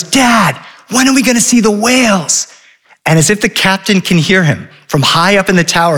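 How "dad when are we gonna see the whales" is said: put-on higher-pitched kid calling out; mild yell. Son calling to Dad over the roar of the wind, mild yell. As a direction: call up to Dad, voice raised in pitch. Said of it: "Henry yells".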